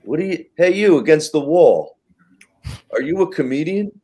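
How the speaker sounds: loud, high mock heckler. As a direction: call out like a pushy heckler. Retelling a heckler's question.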